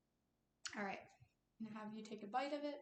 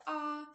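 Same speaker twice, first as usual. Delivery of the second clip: short raised sustained ah; demo ah; 4 semitones higher, 6 dB louder than usual. Shown as a demonstration. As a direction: model the ah for the patient. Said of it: "I'm going to have you go".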